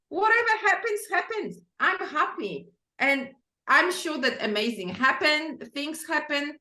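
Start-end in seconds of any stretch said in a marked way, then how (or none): 0.1-2.7 carefree. Retold or imitated. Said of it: "now you're like".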